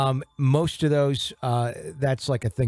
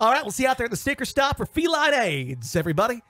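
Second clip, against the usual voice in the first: high, pumped sign-off; cheesy radio voice; 8 semitones higher than usual. Retold or imitated. DJ signing off to listeners, high and pumped. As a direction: sign off like a hyped DJ. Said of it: "I should talk in radio voice".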